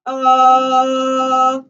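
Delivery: bright tone placed up at the front of the face; a demo of the right, forward placement. Shown as a demonstration. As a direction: push the sound forward into the mask. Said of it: "It should be up here".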